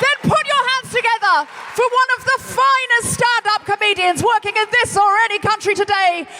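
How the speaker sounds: high, loud host's build-up; compere announcing. Acted. Host announcing London Hughes to the crowd, loud and high. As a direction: bring the next act on big. Host introducing next act.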